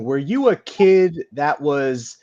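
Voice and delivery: normal talking voice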